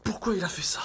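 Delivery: breathy whispered question. Real, not acted. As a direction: whisper it, puzzled and hushed. Teammate disconnected, whispered question.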